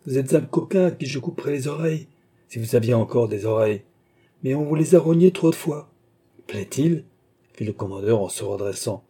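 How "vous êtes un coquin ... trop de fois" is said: ordinary delivery, nothing marked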